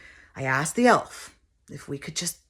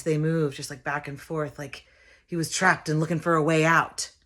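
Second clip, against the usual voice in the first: normal talking voice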